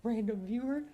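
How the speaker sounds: high, mock-grateful gushing; playfully hammed-up gratitude; flattered reply. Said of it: "And I'm like".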